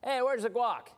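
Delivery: high, pushy mock shout of the line; hammed-up rude guest demanding guac; all caps demand. Shown as a demonstration. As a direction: bark it at the host like a rude guest. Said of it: "In all caps".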